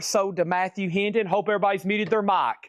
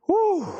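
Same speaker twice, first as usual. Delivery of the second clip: high relieved whew; 3 dB louder than usual. Real, not acted. Exhale after frenzy.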